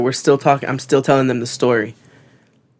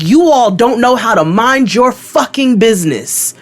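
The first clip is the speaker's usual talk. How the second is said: slow, high, emphatic telling-off; heated, drawn-out rebuke; hot, retold anger; 8 semitones higher, 4 dB louder than usual. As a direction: re-enact scolding, spacing out the words. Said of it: "I'm hot".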